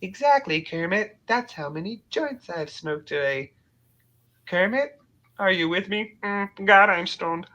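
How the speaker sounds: high, stoned puppet voice; Big Bird. Acted. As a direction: ramble to the frog as a spaced-out puppet. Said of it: "Big Bird".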